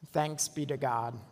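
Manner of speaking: ordinary talk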